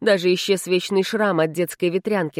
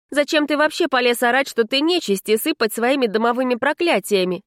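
put-on higher-pitched scolding girl; exasperated, raised-pitch telling-off; indignant heroine; 7 semitones higher than usual. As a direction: demand an explanation from a troublemaker. Heroine scolds the house spirit.

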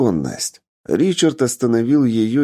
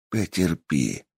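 much softer, low hush; whispering; 4 semitones lower, 6 dB quieter, slower than usual. Acted. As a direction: breathe it quietly. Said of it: "прошептал богатей".

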